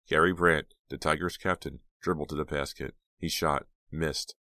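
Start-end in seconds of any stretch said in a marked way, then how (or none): none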